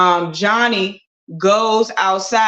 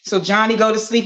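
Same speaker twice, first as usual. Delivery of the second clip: usual speaking voice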